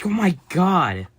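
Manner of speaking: startled. Real, not acted. Scared by the blower again.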